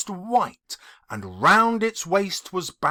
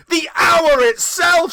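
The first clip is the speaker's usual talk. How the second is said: loud, high triumphant cry; 9 semitones higher, 9 dB louder than usual. A narrator's reading. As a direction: crow it out in victory. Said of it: "said Scrooge triumphantly".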